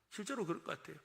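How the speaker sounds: ordinary delivery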